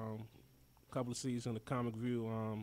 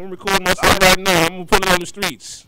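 joking brag; 4 semitones higher, 23 dB louder than usual. Real, not acted. Joking about bootlegging the show.

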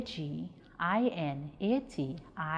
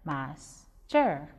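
"Master" split in two with a clear pause; syllable by syllable; 2 semitones lower than usual. Shown as a demonstration. Teacher showing pupils two syllables in "Master", measured and separated. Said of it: "We get two syllables".

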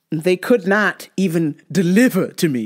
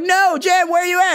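mock friends; 10 semitones higher than usual. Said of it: "everyone's like".

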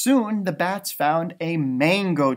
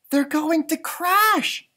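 gasping; 11 semitones higher, slower than usual. A narrator's reading. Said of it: "gasped Stella Luna".

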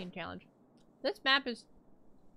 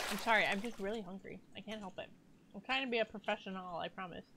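chewing while talking; 2 semitones lower, 9 dB quieter than usual. Real, not acted. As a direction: mouth full, apologetic. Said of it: "I'm gonna eat this last graham cracker".

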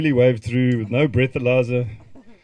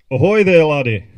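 loud, higher hearty "Ahoy there, laddie"; mock Scottish hail; 3 semitones higher, 11 dB louder than usual. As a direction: call out like a jolly Scottish sailor. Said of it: "the voice note started with".

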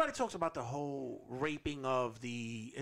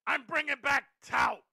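higher, louder rising yell; shouted announcement; 10 semitones higher, 8 dB louder than usual. Acted. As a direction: build to a shout. Boss parody shouting announcement.

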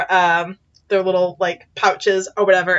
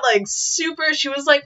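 normal talking voice

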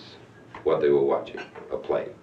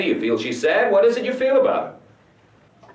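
questions climbing high and louder; heated demand; 12 semitones higher, 4 dB louder than usual. Acted. Director grilling his actress about her feelings, heated. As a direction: push for an answer, voice rising sharply.

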